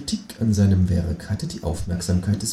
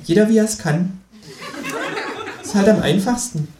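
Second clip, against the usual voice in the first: higher kangaroo voice with a laugh; teasing kangaroo; 9 semitones higher than usual. Acted. Kangaroo teasing the narrator about first person, higher amused voice. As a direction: needle him, amused. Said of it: "sagt das Känguru".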